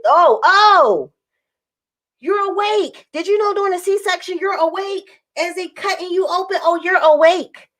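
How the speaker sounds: lightly voiced, high, cheerful explainer; chirpy assistant. Assistant cheerfully telling the dreamy patient, light high chirp. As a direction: play the upbeat helper breaking news.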